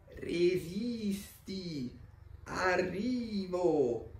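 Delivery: very slow, high, stretched-out call; slow sloth. A narrator's reading. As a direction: call from up high, dragging every vowel. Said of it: "dice Lemmy dall'alto del ramo". (bradipo che chiama lentissimo dall'alto)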